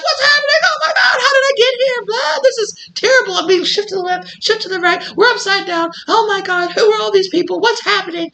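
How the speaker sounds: very high, panicked cries of confusion; terrified panic. Pretend. Waking up on a roller coaster, crying out in panicked terror. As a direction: panic like you woke up mid-ride. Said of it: "You'd be like".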